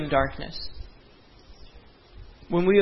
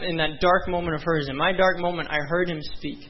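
ordinary talk

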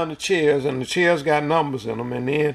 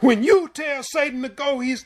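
shouted command, pitched much higher; a booming divine rebuke; 11 semitones higher than usual. Retold or imitated. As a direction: shout it down at him. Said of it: "and he shouted it to me".

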